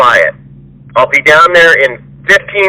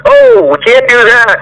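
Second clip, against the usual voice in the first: hammed-up griping impression, pitched up; whiny mock complaint; 8 semitones higher than usual. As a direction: mimic a lazy worker griping about hours. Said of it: "opposing me every fucking day".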